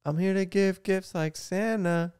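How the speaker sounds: high, slow put-on pop-star voice; mock pop star, drawn out high. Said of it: "What would [name] say".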